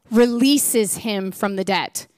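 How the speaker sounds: ordinary talk, nothing marked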